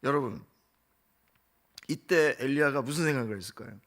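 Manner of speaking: ordinary talk, nothing marked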